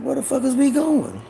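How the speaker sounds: high, alarmed mock question; sudden panic, pitched high. Boy asks mama where she's taking him, high alarm. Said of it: "I'm like".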